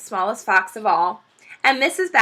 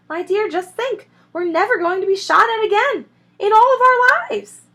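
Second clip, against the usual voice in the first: high, loud voice; excited Rabbit; 11 semitones higher, 5 dB louder than usual. Acted. Rabbit telling Missus Rabbit the news, excited. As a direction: gush to your wife.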